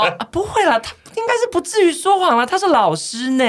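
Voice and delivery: high, placating peacemaker voice; mock fussy committee chair. Committee chair calming the quarrel, high placating. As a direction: soothe the fight in a squeaky voice. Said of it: "那个主委就说".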